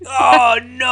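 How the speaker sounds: high loud cry of dismay; frustrated yell. Retold or imitated. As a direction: yell like you just lost again. Reacting to losing the game.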